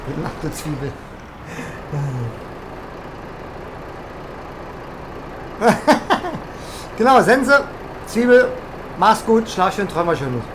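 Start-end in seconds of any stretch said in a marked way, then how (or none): 0.9-6.9 drawn-out breathy laughing fit; long laugh. Real, not acted. Laughing after wishing a viewer good night, long laughing fit. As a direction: let the laugh roll on and on.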